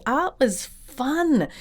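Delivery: ordinary delivery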